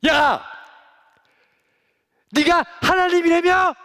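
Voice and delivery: loud, high jeering shouts; taunting yell. Acted. Soldiers taunting Jesus on the cross, loud jeering yell. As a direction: taunt him mercilessly, full voice.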